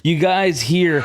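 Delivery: normal talking voice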